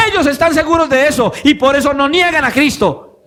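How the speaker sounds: voz de predicador alta y sostenida, recalcando cada frase; prédica enérgica. Real, not acted. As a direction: predica con convicción, en tono agudo y enfático. Predica con fuerza. (prédica apasionada, con énfasis)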